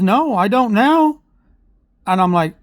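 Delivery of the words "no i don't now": higher, slower, a touch tearful daughter voice; upset daughter. Retold or imitated. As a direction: lightly imitate a hurt kid saying no. Mother quotes upset daughter.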